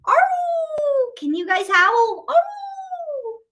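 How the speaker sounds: high, drawn-out wolf howl; long playful monster howl. Shown as a demonstration. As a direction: throw your head back and howl at the moon. Showing how to howl at moon.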